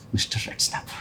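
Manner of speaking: hushed, voiceless whisper; whispered to himself. Acted. Interviewee privately repeating the boss's odd name, whispered. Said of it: "I thought to myself".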